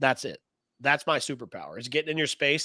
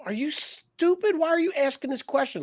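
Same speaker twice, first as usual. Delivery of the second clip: snotty condescending; 13 semitones higher than usual. Retold or imitated. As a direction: sneer it like a bratty daughter. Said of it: "with the connotation of".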